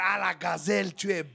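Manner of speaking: crooning sweet-talk to a woman; smooth seducer. Retold or imitated. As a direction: croon it like a charming suitor. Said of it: "Il faut lui dire".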